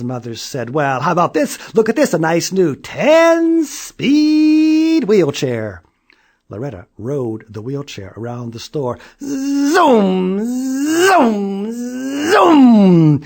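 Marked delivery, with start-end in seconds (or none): 0.7-5.8 eager mother pitch. A narrator's reading. Mother sells wheelchair. 9.2-13.2 high, loud zooming cries; zooming sound. A narrator's reading. Child zooming in wheelchair.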